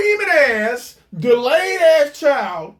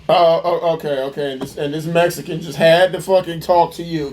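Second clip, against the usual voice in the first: mocking lower voice; 8 semitones lower, faster than usual. Mocking her Walmart story in a low sarcastic voice. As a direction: drop low and mock her.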